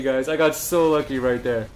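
usual speaking voice

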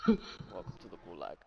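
low, soft announcer voice; deep announcer voice. Pretend. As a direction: say it like a grim announcer. Repeats the announcer welcome.

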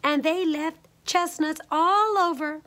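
high, lightly voiced bear complaint; Mama Honey Bear. A narrator's reading. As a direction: complain as a middle-sized bear. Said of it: "in her middle-sized voice".

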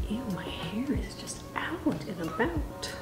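soft unvoiced grumble, slow; whispered aside. Real, not acted. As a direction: breathe it out slowly. Whispered aside about hair.

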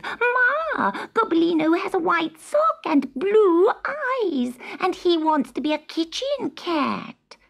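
high, eager little kitten voice; a kitten rushing in with news for Ma; excited kitten Sutica. Acted. Kitten Sutica tells her mother.

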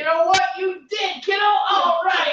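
high-pitched, over-bright parent cheer; loud, gushing praise for a little kid; sarcasm-free enthusiasm. Retold or imitated. Parent praising a thrilled kid, sarcasm scrubbed out. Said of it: "got rid of all the sarcasm in there".

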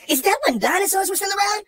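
ordinary delivery

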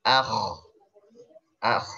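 "Ah" ending in a scraped kh; kho sukun. Shown as a demonstration. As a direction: finish "Ah" with a throaty kh. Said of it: "Posisi rahang ketika ngucapin huruf kho".

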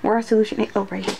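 ordinary talk, nothing marked

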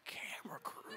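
breathless whispered disbelief; realizing he has no camera crew; panicked whisper. Retold or imitated. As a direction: mouth it in stunned panic.

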